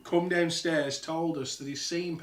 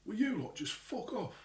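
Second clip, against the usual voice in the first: high, softened irritated plea; faint annoyed call; 8 semitones higher, 5 dB quieter than usual. Retold or imitated. Dad upstairs to noisy lot downstairs, muffled annoyance. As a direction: imitate dad's grumble, heard faintly from upstairs. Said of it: "you can only hear it slightly".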